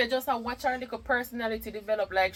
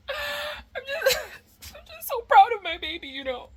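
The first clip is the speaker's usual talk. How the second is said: high, tearful, voice catching with pride; proud mother close to tears; getting emotional; 14 semitones higher than usual. Real, not acted. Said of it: "I'm getting emotional".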